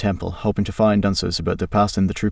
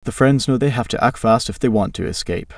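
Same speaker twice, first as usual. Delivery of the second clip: plain reading voice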